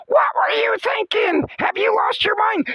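high shrieking scolding grandma; screeching old lady outrage; grandma scream of death. Shown as a demonstration. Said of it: "How about this one?".

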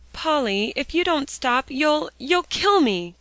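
high, loud and slow, gasping for breath; gasping desperate plea; gasped plea. A narrator's reading. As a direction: beg her through gasps. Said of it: "gasped Alexia".

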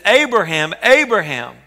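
loud, ringing call across a distance; God calling out. Acted. God calling out to Abraham by name, loud ringing call. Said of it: "He cries out to Abraham".

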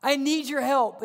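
preacher voicing an urgent appeal; crying out for help. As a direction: call out as someone begging for help. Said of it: "they're crying out".

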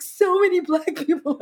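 high-pitched mock wonder; imitating amazed sister. Sister amazed by the crowd, imitated in high wonder. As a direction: marvel in a high put-on voice. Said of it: "looking like she'd never seen people before".

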